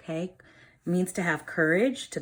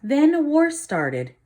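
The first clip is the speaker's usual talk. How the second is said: lively storybook reading for a class; reading to children; 7 dB louder than usual. A narrator's reading. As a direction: read it like a teacher reading a picture book to the class. Reads picture book aloud.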